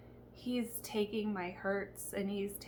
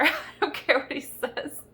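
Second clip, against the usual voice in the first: laughing defiance; 6 semitones higher, 6 dB louder than usual. Retold or imitated. Retold defiance breaking into laughter.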